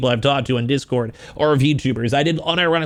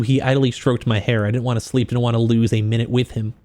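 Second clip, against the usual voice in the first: story narration; 5 semitones lower than usual. Acted. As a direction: read it like an audiobook narrator. Reading smut aloud.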